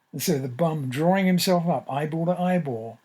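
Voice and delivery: ordinary delivery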